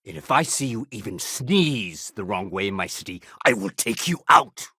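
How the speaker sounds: stern warning that builds in force; menacing threat. Acted. Officer threatening someone in his city.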